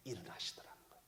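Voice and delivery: awed whisper. Real, not acted. 예수님이 일어나셨다고 전하며, awed whisper. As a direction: breathe it out in a hush. Climax of miracle told.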